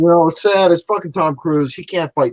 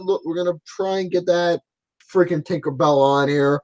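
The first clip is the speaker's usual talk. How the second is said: ordinary talk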